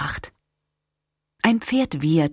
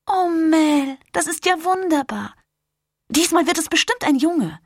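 delighted gush; 11 semitones higher than usual. Acted. Irma delighted at the news.

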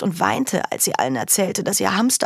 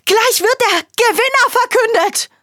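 much higher, louder cry; desperate protest; 6 dB louder than usual. Acted. Girl pleading with her brother, much higher and louder. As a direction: protest before it's too late.

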